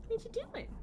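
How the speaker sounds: squeaky high coo at the pet. Real, not acted. Cooing at the pet.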